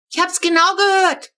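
put-on little girl protesting to her whispering parents; indignant protest. A narrator's reading. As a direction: shout it like a kid who caught every word. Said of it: "protestierte die Kurze".

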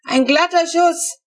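high, loud, slow gloating cry; triumphant. A narrator's reading. Neighbour gloating over the animal he shot, triumphant. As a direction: crow over the kill. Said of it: "hört man Unwisch triumphieren".

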